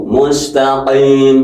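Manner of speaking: usual speaking voice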